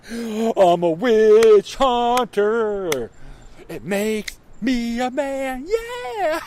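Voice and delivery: high, very slow put-on macho voice; mock tough guy. Host mocks the official as a witch hunter, slow macho drawl. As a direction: drawl it like a pompous blowhard, ending in a yelp.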